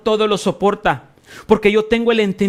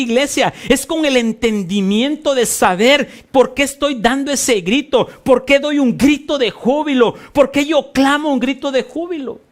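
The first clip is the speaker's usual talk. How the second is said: ordinary delivery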